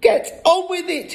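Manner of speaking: high-pitched, frantic urging; impatient hiss. A narrator's reading. Mother hurries her reluctant husband, hissing. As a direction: prod him on impatiently. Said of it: "hissed the mother".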